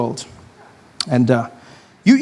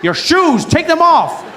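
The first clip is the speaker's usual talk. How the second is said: higher, pushier guard insisting; bossy guard; 10 semitones higher, slower than usual. Retold or imitated. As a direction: bark it like an airport guard.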